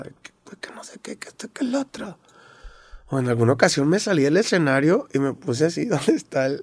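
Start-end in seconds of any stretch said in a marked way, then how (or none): 0.0-2.2 mock whispered yelling. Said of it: "les gritaba".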